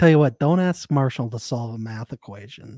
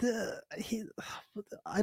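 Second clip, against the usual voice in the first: secretive whisper; hushed conspiratorial whisper; 7 semitones higher, 13 dB quieter than usual. Real, not acted. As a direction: drop to a hush like sharing gossip. Gossip aside, hushed.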